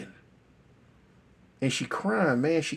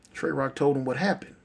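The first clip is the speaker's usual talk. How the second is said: ordinary delivery, nothing marked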